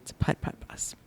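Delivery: hushed, wry whisper; whispered aside. Real, not acted. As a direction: drop to a near whisper. Repeats whispered.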